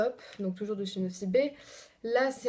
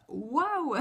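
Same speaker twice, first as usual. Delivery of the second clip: drawn-out, higher-pitched wow; stunned wow; 5 semitones higher than usual. Real, not acted. Vlogger stumbling on a shocking comment, stunned. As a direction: swoop up high in exaggerated disbelief.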